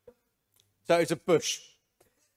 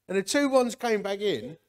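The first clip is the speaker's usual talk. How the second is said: ordinary delivery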